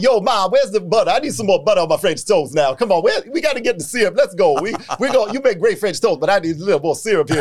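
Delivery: put-on playful suburban Italian kid from New Jersey. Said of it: "I'm a suburban Italian kid".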